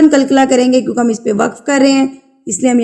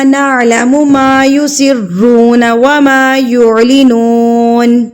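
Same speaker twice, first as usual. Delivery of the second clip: slow, drawn-out tajweed chant; slow melodic Quran recitation for students; 3 dB louder, slower than usual. Shown as a demonstration. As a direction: recite each syllable slowly and melodically.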